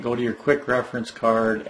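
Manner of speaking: normal talking voice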